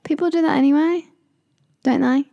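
shouting to a friend at a loud party; shouting over music. Pretend. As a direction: yell as if music drowns you.

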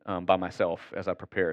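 ordinary talk

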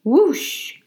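plain reading voice

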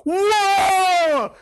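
high, loud astonished cry; amazed whoa. Retold or imitated. Adam shouting in amazement when God brings Eve. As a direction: cry out like a man stunned by beauty. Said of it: "Adam and go".

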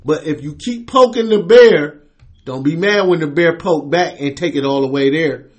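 ordinary talk

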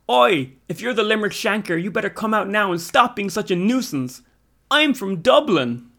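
high, loud defiant yell; mighty shout. A narrator's reading. Lorcan challenges the Shanker across the road, shouting. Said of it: "emerging as a mighty shout".

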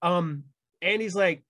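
ordinary talk

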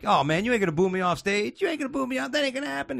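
high-pitched indignant imitation; mock mad. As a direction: mimic a booed comic protesting. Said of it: "He was all mad".

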